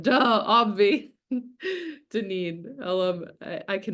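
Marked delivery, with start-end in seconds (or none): 0.0-1.1 slow, drawn-out mock sass, louder; sassy duh. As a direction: toss it off like an eye-roll. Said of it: "Becky said".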